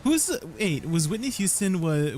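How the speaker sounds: ordinary delivery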